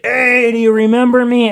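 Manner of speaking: high, loud mock drunk greeting; imitating the drunk guy. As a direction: play the sloppy stranger. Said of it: "And he's like".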